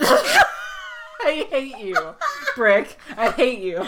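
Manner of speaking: helpless laughter through the words; laughing mock outrage. Real, not acted. Co-host reacting to Brick's milk joke, laughing mock outrage. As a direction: drag out the first phrase, laughing.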